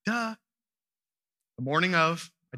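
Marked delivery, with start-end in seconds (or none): 0.0-0.3 put-on high, sassy little girl; sassy kid voice. Dad imitating his daughter brushing off his worry, high sassy kid voice. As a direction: toss it off like a cocky four-year-old. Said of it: "She was like".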